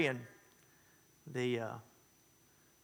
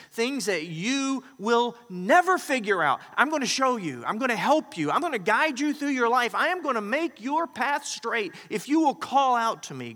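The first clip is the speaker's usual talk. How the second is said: higher and louder, preaching at full force; fervent proclaiming; 7 semitones higher, 6 dB louder than usual. Real, not acted. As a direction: lift the promise up to the rafters. Preacher proclaims God's promise.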